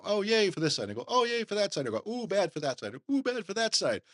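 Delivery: high, hammed-up oohs and yays; mock partisan cheer. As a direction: mimic a fan swinging from side to side. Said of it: "There are times they go".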